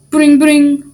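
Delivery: high, loud spoken ringing; imitated phone ring. The phone rings again, loud mimicked ring. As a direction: ring out like a telephone.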